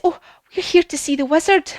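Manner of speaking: high, polite storybook callers; eager visitors. Acted. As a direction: answer the doorkeeper in a high voice. Friends answer the grumpy doorkeeper.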